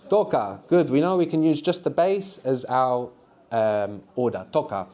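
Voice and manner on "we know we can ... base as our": ordinary talk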